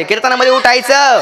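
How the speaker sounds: calling out. Shown as a demonstration. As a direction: call it out so the whole tent answers. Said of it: "मोठ्याने बोला, मंडपाला आवाज गेला पाहिजे".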